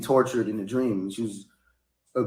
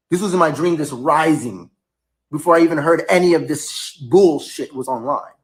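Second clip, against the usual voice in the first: loud, higher, insistent self-defence; swearing it's true, voice raised; emphatic insistence; 4 semitones higher, 7 dB louder than usual. Real, not acted. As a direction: plead your case to the viewers. Insisting to viewers it was a dream.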